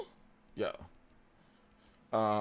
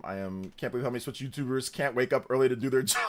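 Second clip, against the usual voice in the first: normal talking voice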